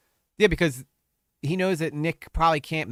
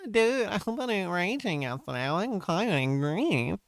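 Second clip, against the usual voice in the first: higher, sneering voice; mocking Nick's reading; 6 semitones higher than usual. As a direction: read it like a whiny, biased guy. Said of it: "if Nick read it, he would have been like".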